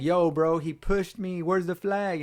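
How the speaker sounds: mock complaint to the referee; Hooker. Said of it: "Even Hooker was like".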